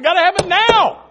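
high, loud demand; mock insistent yell. Knocker refuses to leave without bread, mock insistent yell. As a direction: insist at full voice, play-acting. Acted quarrel at the door.

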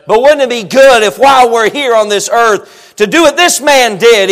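ordinary delivery

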